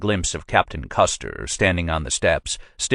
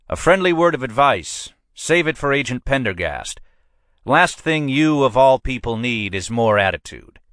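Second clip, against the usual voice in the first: higher-pitched, pushy precinct captain; a captain pressing his man, voiced higher than the narrator; police captain; 7 semitones higher than usual. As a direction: lean on your sergeant like a boss who wants results. Captain warns about attitude.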